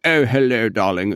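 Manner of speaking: exaggerated posh British greeting; hammed-up upper-class English accent; extreme posh accent. Shown as a demonstration. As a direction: put on a plummy, over-the-top posh English accent. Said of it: "not quite extreme like that".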